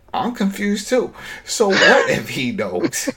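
high-pitched, baffled protest; exasperated confusion. Retold or imitated. Viewer pausing the show to protest, loudly baffled. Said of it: "he was confused".

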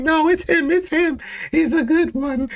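high put-on voice, insisting; Michonne's voice. Host voicing Michonne insisting to Natty, falsetto.

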